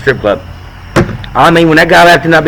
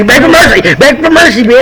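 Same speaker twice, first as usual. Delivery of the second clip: rising mock threat, pitched up; hammed-up rough lover; mock menacing demand; 7 semitones higher than usual. Acted rough bedroom line.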